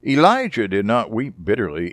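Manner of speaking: usual speaking voice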